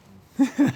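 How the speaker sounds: short high, loud laugh. Real, not acted. Host reassuring viewers he won't undress, real laugh. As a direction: burst out in a brief laugh.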